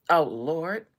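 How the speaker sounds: ordinary talk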